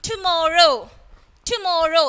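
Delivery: ടുമോറോ ending in a long "oh"; long O demo. Shown as a demonstration. Teacher contrasting tomorrow with how, long O demo. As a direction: say ടുമോറോ separately, showing the final "oh". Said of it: "ഇവിടെ O എന്ന് പറഞ്ഞിട്ടാണ് use ചെയ്യുന്നത്".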